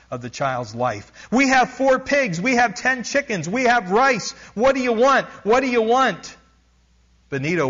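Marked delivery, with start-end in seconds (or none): 1.3-6.4 much higher, louder desperate wail; hysterical screaming. A narrator's reading. Frantic mother pleading with the spirits for her son, screaming. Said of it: "near-hysterical mother screaming at the spirits".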